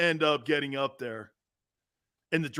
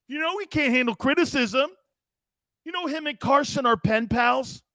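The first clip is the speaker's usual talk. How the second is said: mock defending shout; 6 semitones higher, 5 dB louder than usual. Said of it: "Dank's like".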